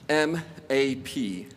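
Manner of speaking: spelled out slowly, letter by letter; each letter said slowly and apart; slow spelling. Shown as a demonstration. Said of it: "spells out the acronym".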